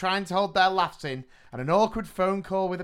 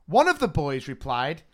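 reading a listener story; 3 semitones higher, 5 dB louder than usual. Acted. Reads a sent-in story.

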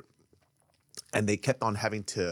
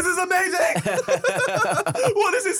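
high, drawn-out overexcited cry; mock amazement; 4 dB louder, much slower than usual. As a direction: act out overwhelmed wonder while laughing. Acting out his younger overexcited self.

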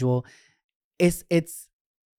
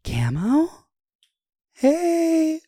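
much higher, louder squealed hello; excited fan greeting; 13 semitones higher, 4 dB louder, much slower than usual. Retold or imitated. Fan calling out to her in the dark. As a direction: call out happily to someone you spot.

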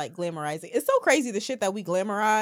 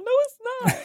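very high-pitched mock protest; teen denial; much faster than usual. Teen denying the fake mom call, high protesting voice. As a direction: squeal it like a caught kid.

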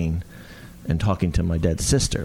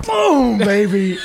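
high, loud victory cry with a laugh; triumphant boast; 11 semitones higher, 5 dB louder, much slower than usual. Retold or imitated. Actor backstage after the show, loud triumphant cheer. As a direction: celebrate like you nailed it. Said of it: "just be like".

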